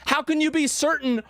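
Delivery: can't believe anyone is sure; incredulous laughing disbelief. Real, not acted. Cohost baffled at people certain about the gun, incredulous.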